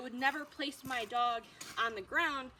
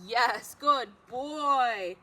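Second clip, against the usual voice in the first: slow drawn-out praise; 11 dB louder, much slower than usual. Real, not acted. Praising dog after down.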